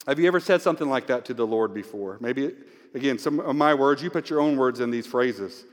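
normal talking voice

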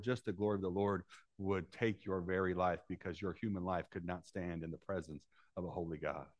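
ordinary talk

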